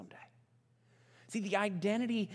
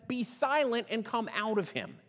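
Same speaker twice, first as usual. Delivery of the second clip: higher-pitched, read-aloud rebuke; 6 semitones higher, 4 dB louder than usual. A narrator's reading. Preacher reads Jesus rebuking the unclean spirit, firm rebuke. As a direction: command it firmly, as scripture read aloud. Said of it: "Jesus rebuked him and said".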